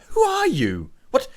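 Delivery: high, startled outburst as a villager; alarmed villager. Acted. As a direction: demand answers in a high, alarmed voice. Startled villager confronts the party.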